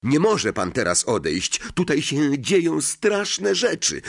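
urgent plea climbing in pitch; exclaimed protest. A narrator's reading. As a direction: implore him to stay, rising at the end. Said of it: "zawołał Harry".

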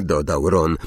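usual speaking voice

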